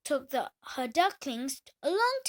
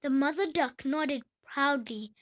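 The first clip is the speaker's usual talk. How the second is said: ordinary delivery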